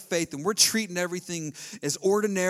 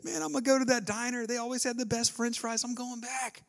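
higher, eager homecoming chatter; light, pumped-up voice of someone going home; lightly voiced Jesus looking forward to the diner; excited Jesus; 7 semitones higher than usual. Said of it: "He was probably excited".

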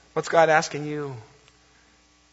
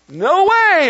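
high and loud panicked no; scared refusal; 13 semitones higher, 9 dB louder than usual. Retold or imitated. Daughter on the zip line refusing to let go, mock panicked. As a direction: refuse in a fearful yelp. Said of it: "And she's like".

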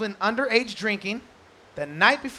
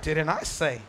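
lower, quieter knowing echo; God's calm reply; 8 semitones lower, 4 dB quieter than usual. Retold or imitated. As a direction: answer back calmly and wryly. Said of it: "And he's like".

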